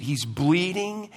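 normal talking voice

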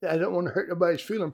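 a light send-up of a sugarcoating preacher; mocking soft preacher. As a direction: brush it off the way a people-pleasing preacher would.

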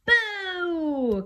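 long high falling "boo"; shouted boo. Acted. Ten little monsters all together, shouted boo. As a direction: shout a playful spooky boo. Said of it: "all shout".

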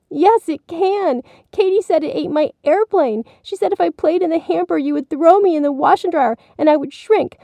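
put-on very high, whiny child voice; distressed little boy. A narrator's reading. Billy telling mommy Katie's story, distressed. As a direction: plead your case in a small high voice. Boy pours out his fear.

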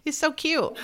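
a touch of high cooing on "so"; cute coo. Real, not acted. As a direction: lightly gush "He's so cute" with a lifted "so". Gushing over the goldfish.

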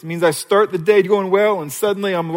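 normal talking voice

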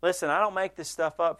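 ordinary talk, nothing marked

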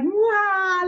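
high, mock baby wail; a hammed-up baby's wah; imitated toddler wail. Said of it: "like, screaming".

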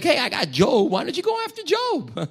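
tossing a servant out casually; mock God offering Job. God to Satan, breezy high offer of Job.

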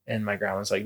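ordinary talk

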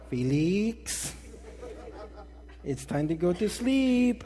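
mock mom calling out, drawn out; imitation of a nagging mother on the line; mom on extension. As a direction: interrupt your kid's call with a slow singsong order. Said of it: "my mom would get on the phone and be like".

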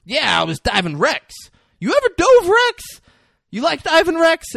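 loud, high, over-eager acted quote; mock excited exclamation. Acting Jackson's eager questions in retelling.

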